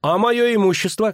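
high-pitched outcry; indignant shout. A narrator's reading. Poet demanding his property from the official, crying out. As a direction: cry out high in protest. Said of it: "крикнул Лютик".

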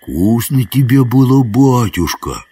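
sweet, high crooning question; honeyed, drawn-out wheedling; most tender singsong. A narrator's reading. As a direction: coo it fondly, stretching the last word. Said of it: "пропел самым ласковым голосом".

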